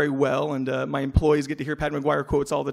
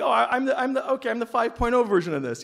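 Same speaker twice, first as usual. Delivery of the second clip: high, loud burst of discovery; suddenly delighted, voice jumping way up; proud eureka moment; excited realization; 10 semitones higher, 5 dB louder than usual. Retold or imitated. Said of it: "I was like".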